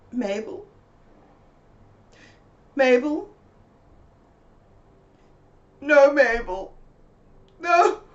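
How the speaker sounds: panicked calling of Mabel; calling her. Retold or imitated. Rousing a still friend.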